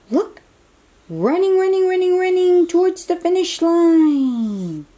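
high, loud, rising cheer as the ostrich runs; excited cheering. Acted.

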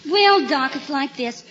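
ordinary delivery

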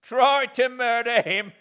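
loud, high scornful retort; disdainful. Acted. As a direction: scoff at the accusation. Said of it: "said my convict disdainfully".

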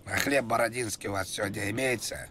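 ordinary talk, nothing marked

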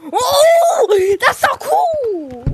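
high, drawn-out cry of delight; thrilled high-pitched whoop; excited squeal. Real, not acted. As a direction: squeal it, stretching the words. Delighted at finding something new.

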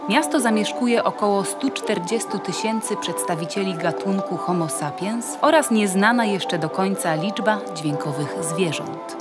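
ordinary delivery, nothing marked

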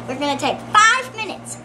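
ordinary delivery

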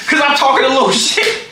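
high-pitched, laughing retort; laughing protest. Retold or imitated. Son protesting dad's threat over trash talk, laughing.

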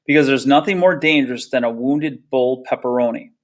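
plain reading voice

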